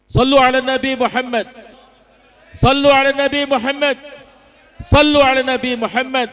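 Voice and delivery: rousing call. Real, not acted. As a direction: call it out to the whole hall. Preacher calls congregation to blessings. (seruan lantang kepada jamaah)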